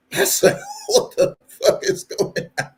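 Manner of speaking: words squeaked out high through laughter; helpless laughter. Real, not acted. Vlogger recalling his shock at gossip, helpless with laughter. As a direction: laugh so hard the voice gives out.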